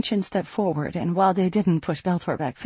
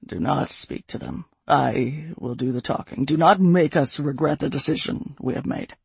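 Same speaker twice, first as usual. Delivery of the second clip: low hateful rumble; 3 semitones lower than usual. A narrator's reading. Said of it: "a low rumble of rolling hate".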